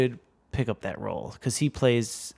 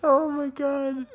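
laughing; 14 semitones higher, slower than usual. Real, not acted. Laughing after quacks.

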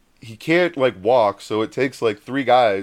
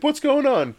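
very high-pitched imitation of a startled friend; alarmed questioning; 14 semitones higher than usual. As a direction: ask it high, in mock alarm. Friend runs out, acted.

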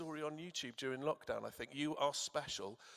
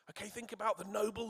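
normal talking voice

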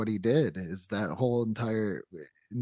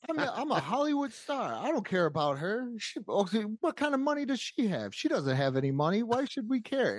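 much higher, sneering Hollywood snob voice through laughter; arrogant Andy Kaufman; 9 semitones higher than usual. Retold or imitated. Podcaster imitating Kaufman's promo to the Memphis crowd, high sneering laugh. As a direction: play the rich jerk mocking the nobody, giggling. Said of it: "He's like".

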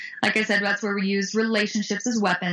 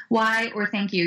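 usual speaking voice

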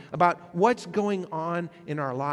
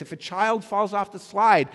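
usual speaking voice

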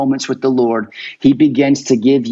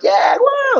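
high-pitched excited cheer; acting out overexcited believers. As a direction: react with giddy, high-voiced wonder. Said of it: "get so excited about God saying".

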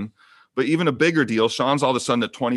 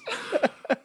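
real squeaky laugh; 3 dB quieter than usual. Real, not acted. Laugh after spitting on cards.

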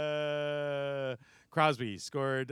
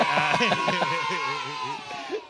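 loud laughing shout; 3 semitones higher, 9 dB louder than usual. Real, not acted.

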